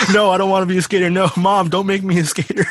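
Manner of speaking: put-on high whining kid, laughing through it; whiny kid voice. Kid whining to mom, mocking.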